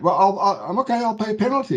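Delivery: bargaining client. Acted. Client offering the bank a way out, lightly acted bargaining. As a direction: stammer out a hurried mock offer.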